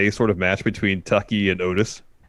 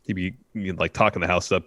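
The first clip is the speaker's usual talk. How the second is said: ordinary delivery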